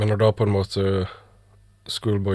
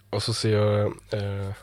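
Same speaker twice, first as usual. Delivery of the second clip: ordinary talk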